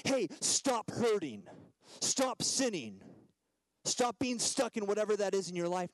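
hammed-up intolerant telling-off; harsh rebuke. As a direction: order them to stop, drawing out each command.

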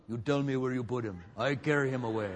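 put-on low comic foreign-accented big woman; Helga with a heavy accent. Imagining Mary as a big girl, comic foreign accent. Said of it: "Maybe it was like Helga".

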